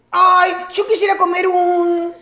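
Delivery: high, slow, whiny longing; craving pregnant woman. Acted. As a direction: moan it like an acted food craving. Acts pregnant craving as a clue. (antojo quejumbroso, agudo y alargado)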